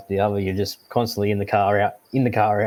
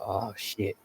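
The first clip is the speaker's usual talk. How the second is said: hushed oh shit; 10 dB quieter than usual. Retold or imitated. Realising it's the police.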